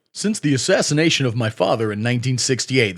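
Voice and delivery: low, strained raspy voice; mock the candidate's strained rasp, pitched low; RFK Jr. impression. Comedian reading the candidate's tweet in his voice, low and raspy. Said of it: "this is how he talks".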